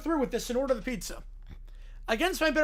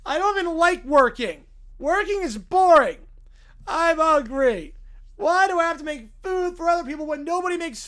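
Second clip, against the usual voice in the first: louder, indignant character voice; whining skit character; 5 semitones higher, 6 dB louder than usual. Acted. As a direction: complain like a put-upon slacker.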